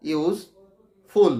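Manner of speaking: ইউজ, a clear pause, then ফুল; slow, syllable by syllable. Shown as a demonstration. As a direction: break ইউজ ফুল in two with a breath between. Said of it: "আস্তে আস্তে বলছি". (আস্তে আস্তে, ইউজ আর ফুল আলাদা)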